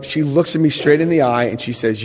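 ordinary talk, nothing marked

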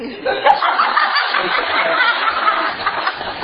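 airy high-pitched burst of laughter. Real, not acted. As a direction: break up laughing at the joke.

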